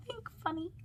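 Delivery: high, hushed little echo; soft echo. A narrator's reading. Pastor explaining a picture to children, softly echoing.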